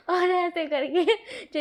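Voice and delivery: slow, laughing start; amused, slowed by laughter. Real, not acted. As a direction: giggle into the words. Laugh before line.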